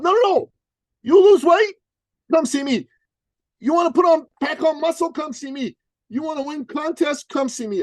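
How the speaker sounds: high, bouncy imitation of an eager trainer; mock sales pitch. Coach mocking Dan's pitch to everyone, high hammed-up voice.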